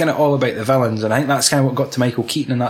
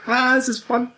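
high, delighted mock glee; gleeful Joker; 11 semitones higher than usual. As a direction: revel in the chaos. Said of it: "He's like".

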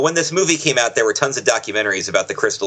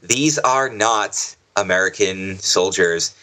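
normal talking voice

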